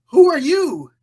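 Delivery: high, loud who are you; retold alarmed shout; startled call. Alice startled by stranger.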